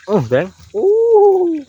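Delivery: a very high, sharp yelp; sudden high startled cry; surprised yelp. Real, not acted. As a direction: yelp as the fish jolts. Reeling in a big fish.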